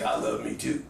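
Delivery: very low, dry mutter; Jimmy Smith. Retold or imitated. Star answering an adoring fan from stage, low dry voice. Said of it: "And he's like".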